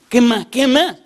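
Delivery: high, loud repeated question; desperate. Retold or imitated. Woman hurries the bookseller through signing, desperate impatience. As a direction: imitate her frantic impatience. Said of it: "así como desesperada".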